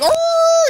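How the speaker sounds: high, loud imitated schnauzer howl; loud high little dog howl; dog bugle howl. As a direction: throw your head back and bay like the dog. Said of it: "He bu".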